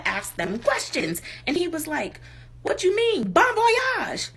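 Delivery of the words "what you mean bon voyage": high-pitched mock indignation; indignant retort. Crackhead answers the woman's question, indignant retort. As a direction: imitate someone affronted by a silly question.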